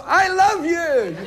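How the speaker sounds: high, drawn-out mock fan cry; hammed-up fan calling out; gushing fan. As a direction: gush it slowly like an adoring stranger. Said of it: "they go".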